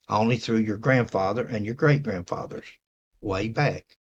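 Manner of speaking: ordinary talk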